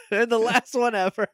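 high, laugh-riddled mock warning; really cracking up while protesting; laughing threat. Real, not acted. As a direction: scold through helpless laughter.

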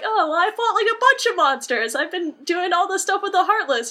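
put-on high, bright young hero pleading his case; eager boastful kid. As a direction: pitch way up like a boy insisting he's a hero. Sora boasting to Phil.

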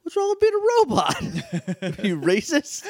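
very high, slow robot squeak with a laugh; mock offended Alpha. Alpha scolding the kid, hammed-up high robot voice. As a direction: play the huffy robot, high and amused. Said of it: "And Alpha's like".

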